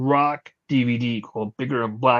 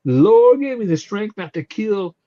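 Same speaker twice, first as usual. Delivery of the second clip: outraged; 6 semitones higher than usual. Retold or imitated. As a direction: scold them in indignant fury. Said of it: "she lost her fucking mind".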